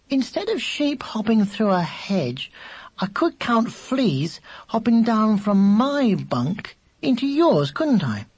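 put-on a young child working out an idea; musing, singled-out words in a child's voice; chattering little brother.

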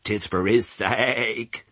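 slow, drawn-out sheep voice. Acted. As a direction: stretch it like a solemn old ewe.

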